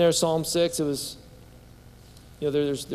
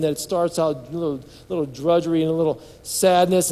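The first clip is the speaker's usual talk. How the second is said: ordinary delivery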